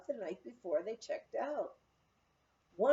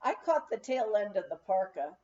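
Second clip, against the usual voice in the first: usual speaking voice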